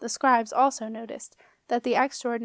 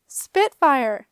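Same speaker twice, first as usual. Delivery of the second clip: louder surprised cry; surprised gasp; 3 semitones higher, 8 dB louder than usual. A narrator's reading. Septimus greeting his dragon, a louder surprised cry. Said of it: "gasped Septimus".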